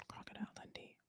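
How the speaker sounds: barely audible whisper; very soft, voiceless echo; whispered aside. Real, not acted. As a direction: whisper the name to yourself. Repeats the name under breath.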